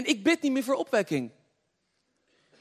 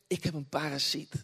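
low, soft mock-timid reply; imitating a subdued sick man; quiet confession; 9 semitones lower, 10 dB quieter than usual. Voices the man's quiet confession.

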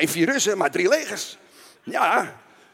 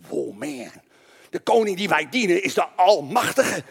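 normal talking voice

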